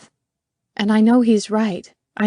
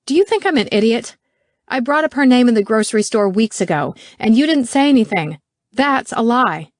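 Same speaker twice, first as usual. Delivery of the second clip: raised, accusing voice, louder; angry; 4 semitones higher, 6 dB louder than usual. Acted. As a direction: confront him with a louder, sharper tone. Angry confrontation in car.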